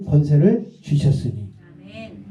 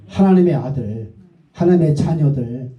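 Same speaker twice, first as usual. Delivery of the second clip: ordinary talk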